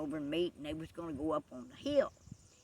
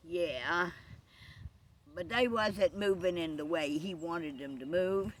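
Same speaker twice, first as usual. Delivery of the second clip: ordinary delivery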